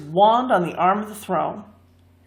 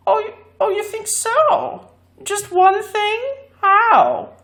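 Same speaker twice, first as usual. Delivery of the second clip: very high, mocking sing-song taunt; saucy; 14 semitones higher, 4 dB louder, slower than usual. A narrator's reading. Glass cat taunting the man, saucy and very high. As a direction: tease him with high mock disbelief. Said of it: "replied the cat saucily".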